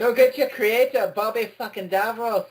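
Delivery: high, loud mock-Scottish taunt; Scottish accent. Playing the Doctor taunting the Daleks, mock Scottish accent. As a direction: taunt them in a rough attempt at Scottish. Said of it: "I can't do a Scottish accent".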